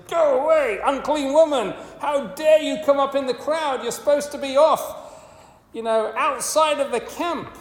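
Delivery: high, loud scolding rebuke; a hammed-up harsh rebuke, pitched high; mock telling-off. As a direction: scold her away as an angry teacher would. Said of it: "she's gonna get a telling off".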